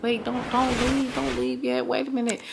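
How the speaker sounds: quiet, coaxing protest; hushed pleading. Retold or imitated. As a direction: gently urge someone to stay. Retold plea not to leave.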